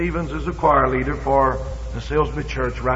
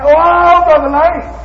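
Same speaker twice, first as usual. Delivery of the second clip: high, loud, drawn-out "Oh"; mock plea; 11 semitones higher, 7 dB louder, slower than usual. Preacher imitating an aimless pleading prayer. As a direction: wail the name up high. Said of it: "saying nothing but".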